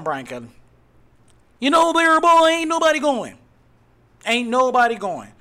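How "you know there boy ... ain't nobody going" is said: high-pitched, loud jeer; loud taunting. Real, not acted. Host taunting a coach nobody followed, loud and high. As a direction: crow it loudly at the departed coach.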